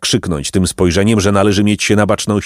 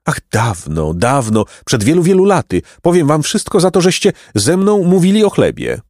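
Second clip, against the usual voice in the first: wistful opening, climbing to a higher pitch; wistful old man; 8 semitones higher than usual. A narrator's reading. Golden old man recalling bread to boys, wistful then eager.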